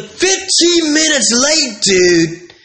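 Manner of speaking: high, slow mock protest; hammed-up customer demanding a free pizza; indignant customer. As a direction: complain the driver's late. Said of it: "He's like".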